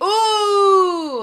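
a high, loud delighted ooh; excited ooh. Real, not acted. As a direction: squeal it with delight. Reacts to female boss story.

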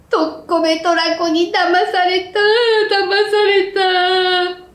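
high, slow, crying wail; feverish wailing. Acted. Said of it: "と叫んでいました".

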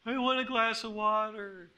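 mock little-kid plea for a drink; whining child. Child stalling at bedtime, put-on whiny plea. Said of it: "when it's like this nonsense".

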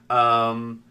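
ordinary talk, nothing marked

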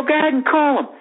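high-pitched goading taunt; retold taunt. Drunk fan goading woman about security, taunting. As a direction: egg them on in a mock quarrel. Acted quarrel, taunting back.

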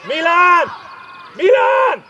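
loud, rising shouts of a name; urgent call. Real, not acted. Coach calling a player on the pitch, insistent shout. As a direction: shout to catch a player's attention.

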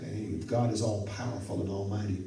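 ordinary delivery

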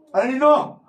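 high, loud, laughing call of padrino. Retold or imitated. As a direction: shout padrino across the room with a laugh. Acts out his godson calling him.

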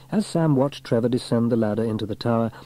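plain reading voice

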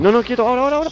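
high loud urgent call; urgent shout. Real, not acted. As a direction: yell it out to stop. Gas fight, urgent.

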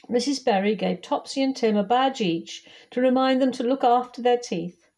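ordinary delivery, nothing marked

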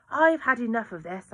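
a frustrated mother breaking into the police talk; angry outburst. Acted. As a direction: snap in, fed up. Aunt interrupts the inspector angrily.

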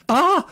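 a sudden high, loud shriek of fright; frightened scream. A narrator's reading. A girl startled by the hoot in the dark courtyard, frightened scream. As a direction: scream as if something jumped at you. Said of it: "schrie ich".

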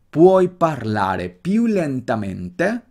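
slow Italian model with well-marked accent. Shown as a demonstration. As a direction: draw the question out slowly, accents well marked. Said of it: "Nota el acento bien marcado".

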